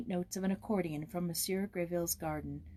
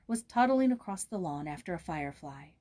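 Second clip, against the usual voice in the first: ordinary delivery, nothing marked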